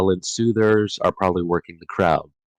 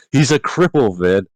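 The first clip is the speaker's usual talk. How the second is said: higher-pitched, incredulous protest; mock exasperation; 4 semitones higher, 4 dB louder than usual. Reader telling Vin off for suspecting Set, mock-exasperated. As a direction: plead with someone being ridiculous, pitched up.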